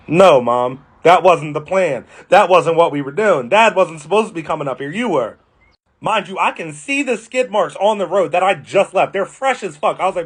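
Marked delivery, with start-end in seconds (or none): none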